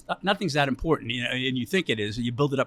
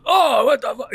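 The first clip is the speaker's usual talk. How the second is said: retold outburst; 9 semitones higher, 8 dB louder, slower than usual. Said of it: "he goes".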